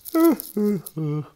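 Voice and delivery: shrill ghost wail, very loud; high ghostly wail. Acted. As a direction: let out a piercing ghostly cry. Ghostly sob-laugh after muffled sobs.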